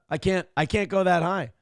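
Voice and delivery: ordinary delivery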